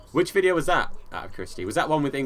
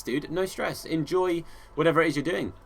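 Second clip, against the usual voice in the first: ordinary delivery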